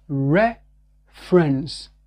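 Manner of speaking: reference drawn out very slowly, English R. Shown as a demonstration. Teacher drilling reference for learners, very slow. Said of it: "c'est pas référence".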